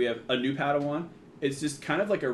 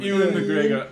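laughing shout; 3 semitones higher, slower than usual. Pretend.

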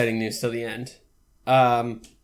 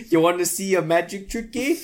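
higher-pitched hammed-up Canadian accent; cartoon Canadian, pitched up; mock Canadian accent; 4 semitones higher than usual. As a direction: put on a jokey Canadian lilt. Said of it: "we're Canadian".

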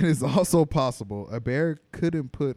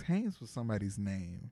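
ordinary talk

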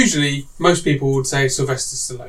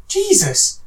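amazed reaction; 13 semitones higher than usual. Retold or imitated. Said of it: "you watch them and go".

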